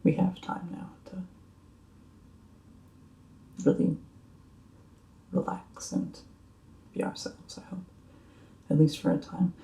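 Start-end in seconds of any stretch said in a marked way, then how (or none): 0.0-7.8 hushed, wistful hope; soft. Acted. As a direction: breathe it gently.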